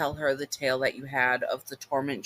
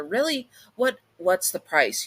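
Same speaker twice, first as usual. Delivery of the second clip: surprised; 5 semitones higher than usual. Acted. Summoner surprised by demon's offer.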